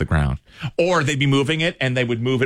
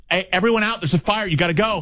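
mock urgent evacuation call, higher pitch; imitated fire warning; 7 semitones higher than usual. Somebody warns shoppers of a fire, mock urgent. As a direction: imitate someone ordering everybody out. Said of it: "unless you hear somebody go".

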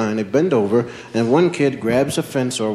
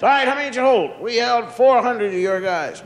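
put-on higher kid voices; lightly voiced kids; 10 semitones higher than usual. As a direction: rivals sizing up the score. Kids talking after the game.

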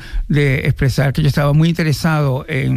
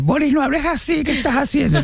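higher, mock scolding mom voice; imitating his mother; 11 semitones higher than usual. Mother on the phone scolding her son, in a higher mock voice. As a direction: play his mother, startled and scolding, pitched up. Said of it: "Y mi mamá decía".

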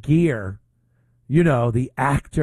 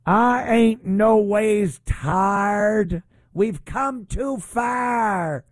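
high, very slow, drawn-out mock sermon; mock Southern church accent; 8 semitones higher, much slower than usual. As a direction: ham up a fake preacher cadence, stretching each word.

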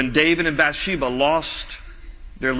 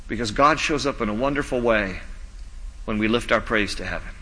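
ordinary talk, nothing marked

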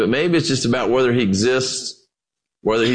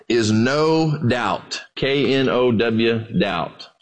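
slow, spelling out K N O W then doubt; spelled out title; 5 semitones lower, much slower than usual. Shown as a demonstration. Pastor spelling out his sermon title pun, slow and deliberate. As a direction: spell the pun slowly, letter by letter. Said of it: "title of today's talk".

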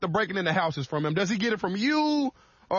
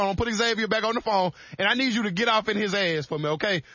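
ordinary talk

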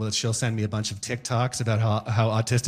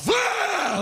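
put-on old man sneeze. Comic imitating his sudden old man sneeze, shouted. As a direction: blast out a sneeze with no wind-up. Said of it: "old man sneezes".

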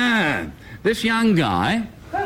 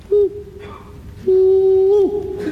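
bunyip reply; 11 semitones higher than usual. Acted. Bunyip answers from the back of the cave, high hooting call. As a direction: hoot back like the creature in the dark.

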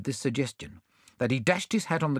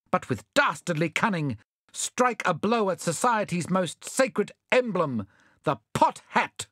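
high, grand speech; oratory; 6 semitones higher than usual. A narrator's reading. Bunyip Bluegum denounces the thieves in high oratory. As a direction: orate with mock grandeur. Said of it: "a fine burst of oratory".

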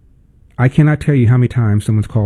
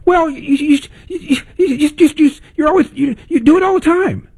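high, whiny stammer, stuck on you; mock him tripping over his words, high and whiny; stammering mock caller; faster than usual. Host mocking a caller stuck for an example, high stammering whine.

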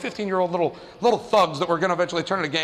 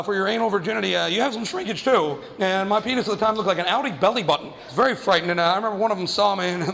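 ordinary delivery

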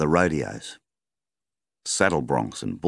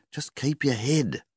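higher, slow, calming; wife's voice; 11 semitones higher, slower than usual. A narrator's reading. Wife urging her husband to stay calm, higher. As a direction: settle him down.

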